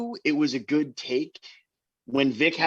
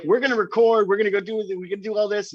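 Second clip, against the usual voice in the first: mock eager band; 7 semitones higher, 4 dB louder, faster than usual. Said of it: "we're like".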